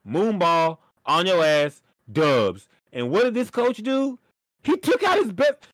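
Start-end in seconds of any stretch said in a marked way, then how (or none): 4.6-5.7 heated outburst. Real, not acted. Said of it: "I'm sorry, bro".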